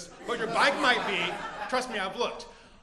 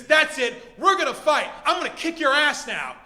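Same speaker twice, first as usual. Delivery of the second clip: loud, high acted challenge; angry threat; 4 semitones higher, 9 dB louder than usual. Thief fed up with being accused, loud angry threat. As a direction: square up for a fight.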